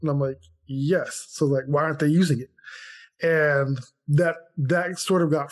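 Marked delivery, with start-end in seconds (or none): none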